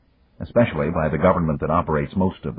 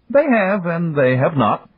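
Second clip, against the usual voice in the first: put-on higher, measured voice of the old magnate; Yamagata; 13 semitones higher, 5 dB louder than usual. A narrator's reading. Tycoon Yamagata answering old friend Dan, higher, measured. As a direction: play the calm, wise Japanese tycoon. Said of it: "replied Yamagata".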